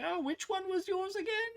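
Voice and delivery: very high, cheeky rascal voice; teasing monk. Acted. Rascally monk teasing the robbed guys, very high. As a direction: toy with them like a smug trickster. Monk shows stolen weapons.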